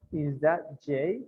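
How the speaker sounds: slow, careful classroom model; slow model question. Shown as a demonstration. Teacher modelling the question slowly for learners. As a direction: say it slowly so students can copy. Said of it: "teacher modelling the question".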